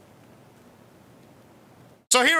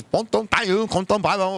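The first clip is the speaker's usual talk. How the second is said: mock Chinese; 5 semitones lower than usual. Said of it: "She said something in Chinese".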